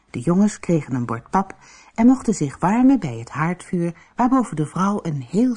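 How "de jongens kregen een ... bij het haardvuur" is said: ordinary delivery, nothing marked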